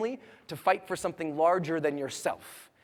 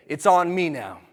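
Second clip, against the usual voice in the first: firm and louder, declaring it; said with strength; 9 dB louder than usual. Shown as a demonstration. Leading the crowd to say it with strength. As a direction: own it out loud. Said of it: "say it with strength in your body".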